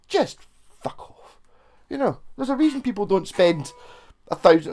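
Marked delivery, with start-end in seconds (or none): 0.1-1.4 high then hushed brush-off; mock brush-off. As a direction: dismiss the snob in one breath. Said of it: "And you're just like".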